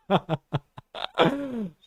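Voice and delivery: breaking into a high laugh. Real, not acted. Streamer to chat, bursting into a high laugh. Laugh alone after joke.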